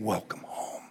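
very soft, low, hushed greeting; softly forgiving; tender welcome. Retold or imitated. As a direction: welcome him gently, almost whispering. Greeters forgive their killer in heaven.

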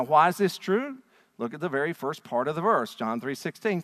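ordinary talk

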